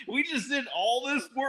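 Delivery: high-pitched incredulous whine; exasperated protest. Retold or imitated. Retold protest after hauling crib home.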